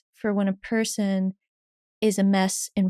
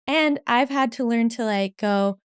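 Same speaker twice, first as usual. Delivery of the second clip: ordinary talk, nothing marked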